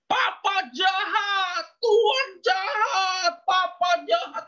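high, drawn-out wailing shout; jeritan sambil menangis. Retold or imitated. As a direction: imitate a girl screaming through tears, stretching each word. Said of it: "langsung nangis, ngejerit gitu. Dia langsung teriak". (tiruan jeritan tangis, tinggi dan panjang)

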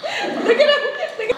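high, slow, laughing words. Real, not acted. Owner laughing at dogs fighting over a duck. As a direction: giggle it out.

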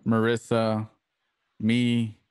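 ordinary talk, nothing marked

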